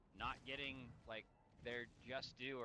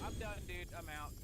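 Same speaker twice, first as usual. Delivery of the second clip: higher, louder fed-up quote; retold exasperation; 8 semitones higher, 4 dB louder than usual. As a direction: toss off the fed-up retort. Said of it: "I was like".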